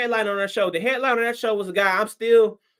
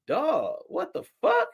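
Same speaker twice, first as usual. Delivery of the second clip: exasperated disbelief; 6 semitones higher, 5 dB quieter, slower than usual. Retold or imitated. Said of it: "I'm like".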